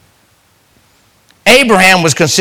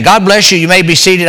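ordinary delivery

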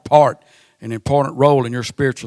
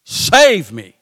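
slow, louder half-scream; mock scream; 6 semitones higher, 5 dB louder, much slower than usual. Sinking Peter screams to the Lord, a slow hammed-up cry. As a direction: cry out as if the waves are closing over you. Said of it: "he screamed".

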